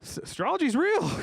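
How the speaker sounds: very high, wide-eyed mock awe; squeaky mock wonder; mock amazement. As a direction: gasp it out like a sudden convert. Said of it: "I would've been like".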